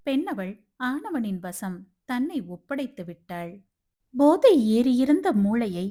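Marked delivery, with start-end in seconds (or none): none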